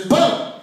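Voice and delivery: loud whispered boom; explosion noise. Acted. Religions ending the argument, loud boom sound. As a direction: blast it out like a bomb.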